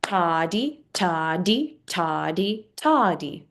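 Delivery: two quick syllables per beat; rhythmic toddy count. Shown as a demonstration. Teacher counting eighth notes, rhythmic toddy. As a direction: chant toddy in even eighths. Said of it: "So half and half".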